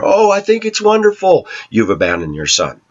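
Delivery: high, sing-song mock sweetness, then dropping; passive-aggressive. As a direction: imitate a falsely delighted ex in a high voice. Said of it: "Passive-aggressive behavior".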